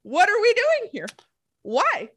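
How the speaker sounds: high exasperated complaint; incredulous, fed-up rant. Retold or imitated. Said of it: "I'm like".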